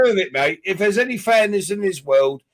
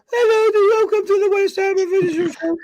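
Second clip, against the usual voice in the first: much higher, mocking the young presenter's squeak; squeaky kid voice. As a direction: squeak it out like a kid hosting his first show. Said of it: "Do that voice again".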